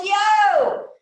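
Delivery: a loud, high "Yo!" thrown across a valley; yell. Retold or imitated. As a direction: call out to the far mountain, loud and high. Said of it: "so he yells".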